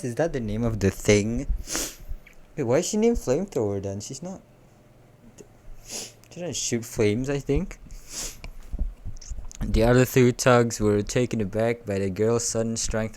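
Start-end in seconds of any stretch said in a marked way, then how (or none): none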